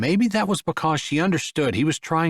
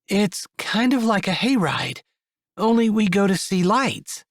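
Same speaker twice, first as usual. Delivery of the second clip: put-on higher young woman, explaining; 8 semitones higher than usual. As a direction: lift into a bright explaining voice. Esther voiced.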